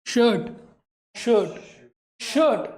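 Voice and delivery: शर्ट said shut, no r at all; silent r shirt. Shown as a demonstration. Teacher tells class शर्ट has silent r. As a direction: drop the r in शर्ट, long vowel. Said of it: "आर नहीं बोलना है".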